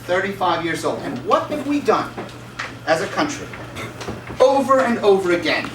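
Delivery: normal talking voice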